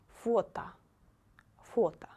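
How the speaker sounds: Фото said "FO-ta"; final O as A. Shown as a demonstration. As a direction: model Фото with the last O reduced to "ah". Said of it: "It will be A".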